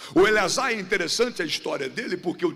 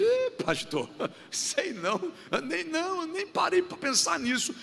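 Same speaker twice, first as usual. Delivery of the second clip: higher and softer, hesitant; a bashful churchgoer caught off guard; a hint of a timid churchgoer; shy listener; 9 semitones higher, 4 dB quieter than usual. Voicing an unsure listener.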